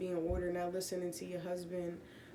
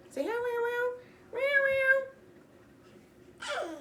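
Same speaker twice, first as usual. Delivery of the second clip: meow; 6 dB louder than usual. Pretend. Meowing for the cat.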